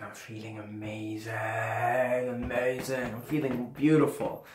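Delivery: slow, dreamy whisper; drawn-out whisper of bliss. Retold or imitated. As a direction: breathe it out slowly, floating. Blissed out on mushrooms.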